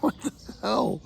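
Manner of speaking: high, disbelieving what the hell; half-laughing astonishment at the road; exasperated surprise. Retold or imitated. Hiker back on the same road, high disbelieving laugh. Reaching road he had left.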